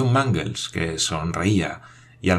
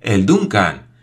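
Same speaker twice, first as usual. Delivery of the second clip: louder cry of despair; 5 dB louder, slower than usual. A narrator's reading. Paganel seeing the ship's name on the wheel, despairing cry. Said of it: "exclamó con verdadera desesperación".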